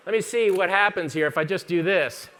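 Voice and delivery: usual speaking voice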